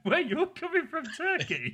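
put-on high-pitched parody of a botched Welsh accent; mocking accent imitation. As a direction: mimic the bad Welsh accent, breaking into laughter.

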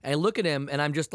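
ordinary talk

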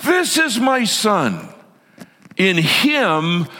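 high, drawn-out booming proclamation; thunderous God. Acted. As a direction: proclaim it from heaven like monsoon thunder. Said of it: "like, monsoon thunder voice".

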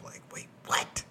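hushed, confused; whispering. Real, not acted. Unvoiced, confused waking.

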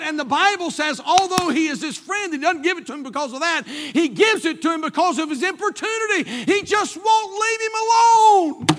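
high, loud sermon building to a shout; preacher's climax, last words stretched and shouted; full-force preaching. Real, not acted. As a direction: drive the point home from the pulpit.

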